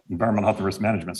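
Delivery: usual speaking voice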